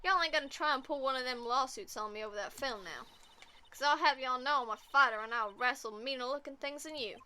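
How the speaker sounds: scrappy southern fighter voice; southern drawl. Acted. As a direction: warn them off in a southern drawl. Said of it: "I like speaking in a southern drawl".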